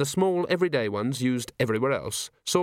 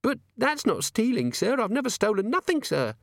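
put-on high, anxious young maid voice; nervous maid; 12 semitones higher than usual. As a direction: plead innocently as the maid.

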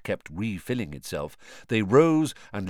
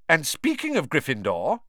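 sharp; 7 semitones higher than usual. A narrator's reading. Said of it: "Professor McGonagall said sharply".

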